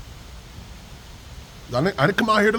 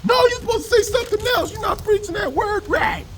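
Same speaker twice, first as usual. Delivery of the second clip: much higher, fast mock whine; mocking high shrill critic; 14 semitones higher than usual. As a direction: ape the complaining woman. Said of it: "mocking critic's voice".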